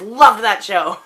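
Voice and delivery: fond laugh as you say it; laughing fondly. Real, not acted. Laughs while remembering.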